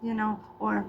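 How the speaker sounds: ordinary talk, nothing marked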